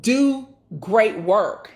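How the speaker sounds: "Do great work" slow, each word set apart; emphatic. Real, not acted. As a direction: stretch out "Do great work", pausing between words. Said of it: "I have to emphasize that".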